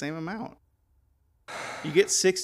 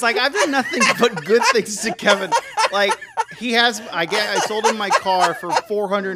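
laughing; 5 semitones higher, 8 dB louder than usual. Real, not acted. Laughing at the pink eye plan.

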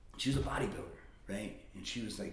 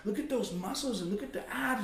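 high, gushing mock exclamation; excited admiring aunt; 8 semitones higher than usual. Aunt gushing over her shirtless nephew, excited. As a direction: imitate an aunt fawning over muscles.

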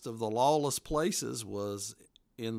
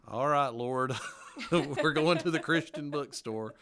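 loud, laughing mock prayer; amused plea to the Lord, breaking into laughs; laughing at the memory; 4 semitones higher, 6 dB louder than usual. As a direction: chuckle through it, voice lifted and loud. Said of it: "I'm laughing thinking back at it".

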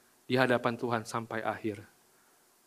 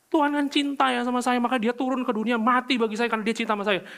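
high, louder mock of a self-satisfied believer; smug self-assurance; 9 semitones higher, 4 dB louder than usual. Preacher imitates a believer sure God loves them, high and smug. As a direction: sell it like you deserve the love. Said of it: "kita bisa bilang".

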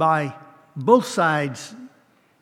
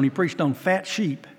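ordinary talk, nothing marked

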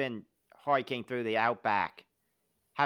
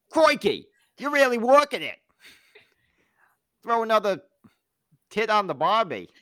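Australian dirty talk; 10 semitones higher, 8 dB louder than usual. Retold or imitated. Imagined Australian lover talking dirty, in a hammed-up higher accent. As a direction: ham up a mock Australian seducer. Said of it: "Australian dirty talk".

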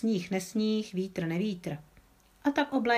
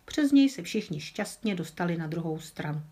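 usual speaking voice

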